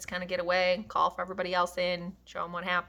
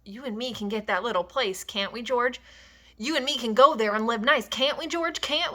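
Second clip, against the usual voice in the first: put-on rising, pleading old man; begging George for the dream, voice climbing higher; desperate hope, higher and higher; 5 semitones higher, 6 dB louder than usual. A narrator's reading. Candy pleading for the ranch.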